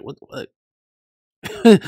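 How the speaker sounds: very slow, loud, drawn-out mock disbelief; imitating a shocked character. Retelling a character's reaction to chat, a hammed-up slow loud what. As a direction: stretch it out like you can't believe it. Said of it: "He was like".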